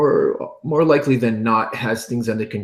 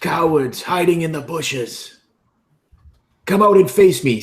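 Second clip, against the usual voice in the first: Elidor's taunting yell; 6 semitones higher than usual. Acted. Elidor taunts goblins to draw their fire, mock-heroic yell. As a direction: call the enemy out, rising to a shout. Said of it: "Elidor would just yell".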